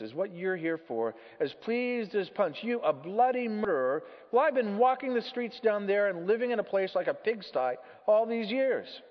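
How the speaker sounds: high, loud, bitter complaint; aggrieved ghost. Acted. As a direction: rant like a wronged ghost.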